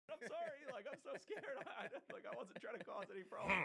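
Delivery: high, laughing apology. Real, not acted. Retelling how he was chased off, laughing.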